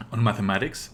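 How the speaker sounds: ordinary talk, nothing marked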